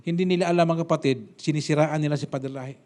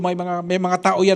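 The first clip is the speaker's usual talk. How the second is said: ordinary talk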